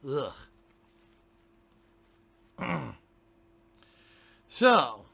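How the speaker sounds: ordinary delivery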